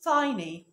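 tiny said as TY-nee with a long i, the correct way; correct pronunciation. Shown as a demonstration. A teacher shows students tiny the right way. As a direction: model tiny clearly with the long i sound. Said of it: "النطق الصح بتاعها".

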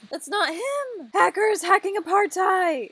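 high-pitched alarmed wail; hammed-up hysterical alarm; mock panicked chat. Reads out panicked chat messages.

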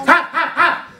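high, loud triple bark; dog barking. Acted. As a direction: bark sharply like a watchdog. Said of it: "On štěkal". (ostrý psí štěkot)

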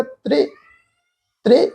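ਤ੍ਰੇ short and light, a brief e; touch ਤ੍ਰੇ lightly, without opening to "trai". Shown as a demonstration. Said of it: "ਹਲਕੀ ਜਿਹੀ tone ਵਿੱਚ". (ਤ੍ਰੇ ਹਲਕਾ ਜਿਹਾ, ਛੋਟੀ ਆਵਾਜ਼ ਨਾਲ)

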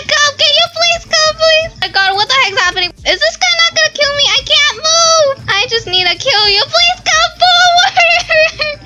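high pleading. Real, not acted. Gamer begging her opponent to come fight, high and pleading. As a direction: coax the other player forward, pleading high.

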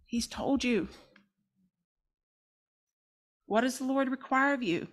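soft, gentle reminder; gentle God. Acted. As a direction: remind them kindly and quietly. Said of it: "God is always so gentle".

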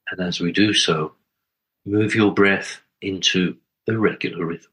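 calm, spacious meditation leading; guided meditation. Acted. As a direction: guide listeners gently inward, phrase by unhurried phrase.

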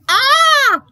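very high, loud scream; loud startled yell. A narrator's reading. Daddy frightened by clown face, loud high yell. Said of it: "the voice yelled real loud".

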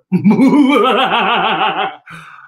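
loud gloating cackle; villainous spider cackle. Acted. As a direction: cackle over the caught bug. Spider gloats after catching bug.

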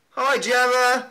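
greeting a fan in a voice message, high and slow; sing-song greeting. Pretend.

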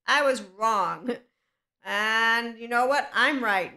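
loud, drawn-out admission that flips to smug; mock concession. Owning her mistake to a pouty person, then mock smug. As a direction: concede slowly, then snap back with a cheeky twist. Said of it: "I'm gonna be able to say".

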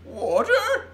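loud, very high baffled question; puzzled giant, voice leaping up; frowning giant. A narrator's reading. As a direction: exclaim it as a mighty frown. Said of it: "frowning mightily".

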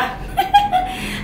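a sudden high burst of laughter. Real, not acted. Vlogger cracking up at her own joke, high laugh.

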